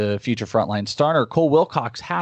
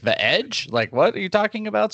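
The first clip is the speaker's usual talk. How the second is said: incredulous; 9 semitones higher than usual. Retold or imitated.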